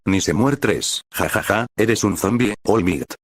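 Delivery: usual speaking voice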